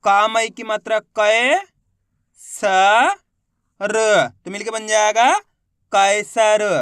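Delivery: drawn "कै", "स", "र" apart, then firm "कैसर"; letter-by-letter sounding out. Shown as a demonstration. Teacher modelling for learners to copy, syllable by syllable. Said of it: "एक अक्षर का उच्चारण करके इनको पढ़".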